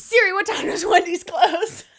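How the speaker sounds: high, loud call to the phone; calling to Siri. Retold or imitated. Retelling the drive round the loop, high shouted call. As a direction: yell the question at a voice assistant.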